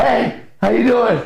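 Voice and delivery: high, drawn-out fawning hello; eager puppy greeting. Acted. Smitten man greets woman next night, fawning. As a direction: fawn like a dog with a wagging tail. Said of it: "your tail's wagging".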